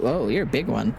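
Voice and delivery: ordinary talk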